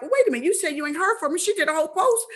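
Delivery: retold confusion. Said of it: "That was my confusion".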